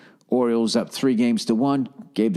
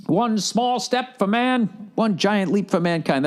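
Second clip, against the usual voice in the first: slow, much higher mock moon-landing announcement; lightly mock historic broadcast voice; astronaut quote; 8 semitones higher, slower than usual. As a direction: ham up the famous first words on the moon.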